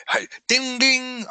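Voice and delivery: high, sing-song ding like a shop bell; mock doorbell chime up high; imitating a bell. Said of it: "I don't have my little bell".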